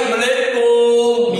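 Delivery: waalaikum cut down to a long drawn-out "mlekum"; slurred short greeting. Shown as a demonstration. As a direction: swallow the first syllables of waalaikum, drag out the rest. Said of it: "Makin jauh lagi". (waalaikum dipendekkan jadi "mlekum" panjang)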